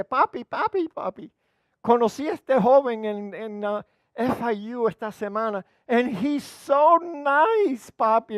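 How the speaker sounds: high, swooning voice stretching "so nice"; gushing daughter. Retold or imitated. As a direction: gush like a girl in love. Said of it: "me dijo tres veces". (hija enamorada, voz aguda y melosa)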